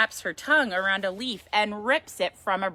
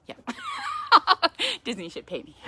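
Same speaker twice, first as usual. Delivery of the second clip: ordinary delivery, nothing marked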